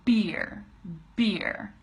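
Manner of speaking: "beer" with lips popping open on B; isolated, careful "beer"; B sound. Shown as a demonstration. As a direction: say "beer" with a clean burst of B. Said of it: "throw your bottom lip out".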